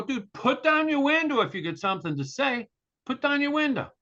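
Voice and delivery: high, insistent mock shout; exasperated. Driver to yelling man behind glass, exasperated. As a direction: demand it, pitched well up. Yells back through closed window.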